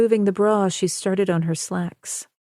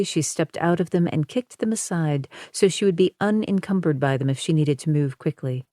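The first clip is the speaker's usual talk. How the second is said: usual speaking voice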